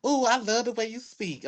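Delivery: high-pitched, lightly mimicked gushing; imitated admirer. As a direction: play someone charmed by an accent. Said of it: "Now it's like".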